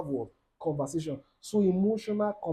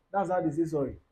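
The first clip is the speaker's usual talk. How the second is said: normal talking voice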